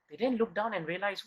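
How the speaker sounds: usual speaking voice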